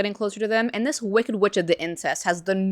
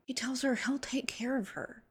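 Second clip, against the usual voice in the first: whisper; 6 semitones higher, 11 dB quieter than usual. Real, not acted. Whispered narration aside.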